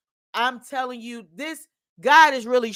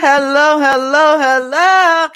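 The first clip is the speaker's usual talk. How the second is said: loud greeting; 3 semitones higher, 12 dB louder than usual. Acted. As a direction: call out hello like opening a show. Host's loud sing-song podcast opener.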